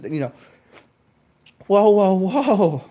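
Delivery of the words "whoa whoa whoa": loud, slow, high whoa; hammed-up shock at bad words; mock alarm. Mock outrage at swearing.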